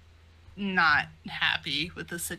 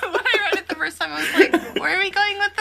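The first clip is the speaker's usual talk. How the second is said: high, loud, laughing and fast; high laughing disbelief; 12 semitones higher, 7 dB louder, faster than usual. Real, not acted. Reader recalling a baffling panel, laughing high and loud. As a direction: squeal it out through laughter.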